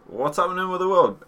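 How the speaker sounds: high loud exasperated rant; mock rage. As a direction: rant at the world, half joking. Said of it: "I touch on politics in real life when I'm like".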